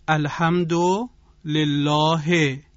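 slow demo in a Persian accent; firm, deliberate Persian-accented reading. Shown as a demonstration. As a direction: say the Arabic with Persian vowels, spaced out.

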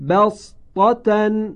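بسطة chanted slow and high, letters drawn out; Quranic recitation. Shown as a demonstration. Teacher recites a Quran word for listeners, slow chant. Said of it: "این کلمه است".

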